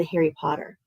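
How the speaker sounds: ordinary talk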